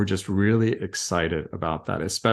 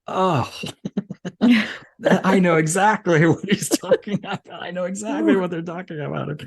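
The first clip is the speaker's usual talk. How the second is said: high, animated outburst through a laugh; laughing, high-pitched mock outburst; retold exclamation; 10 semitones higher, 4 dB louder than usual. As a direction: act out exclaiming at the car radio, pitched well up. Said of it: "I'm driving and I'm like".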